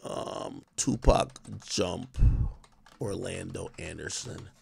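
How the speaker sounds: very low, soft self-talk; slow, hushed words to oneself while typing; absently reading aloud. Real, not acted. Streamer typing a YouTube search, quiet absent self-talk.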